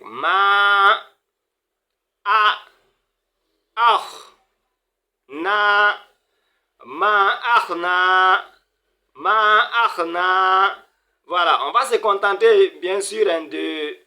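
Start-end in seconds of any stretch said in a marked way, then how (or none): 0.0-10.9 "Ma, a, ah, na" higher, syllables drawn apart; long vowel. Shown as a demonstration. Teacher spelling out the long vowel for students. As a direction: stretch the long a in "ma ah na". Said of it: "il y a un voyelle longue".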